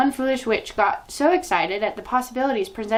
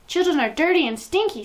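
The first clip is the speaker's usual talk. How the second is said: high, loud witch scream; 9 semitones higher, 4 dB louder than usual. A narrator's reading. Grand High Witch screaming to the assembled witches. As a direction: scream it with disgust. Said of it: "screamed the Grand High Witch".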